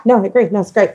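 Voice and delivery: usual speaking voice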